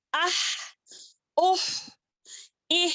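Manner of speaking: breath released on ah; demo with sukoon. Shown as a demonstration. Teacher showing students how breath runs out. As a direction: sound it out with the breath running. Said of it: "ساکن لگا دیں گے تو کیسے ہوگا؟".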